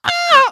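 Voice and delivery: high, loud gasp of surprise; high gasping wow. Real, not acted. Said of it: "that was a gasping wow".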